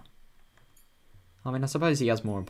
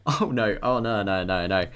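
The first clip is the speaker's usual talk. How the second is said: loud dismay; 8 dB louder, slower than usual. Real, not acted. Dismay at story turn.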